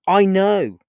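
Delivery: loud, high-pitched burst; immediate retort. A narrator's reading. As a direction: cut in at full volume, pitch jumping up. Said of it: "replied Paul immediately".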